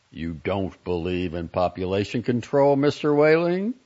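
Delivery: stern challenge climbing to a high, loud question; severe, pointed questioning; rather severe. A narrator's reading. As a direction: tower over him with the question. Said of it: "became rather severe with Whaling".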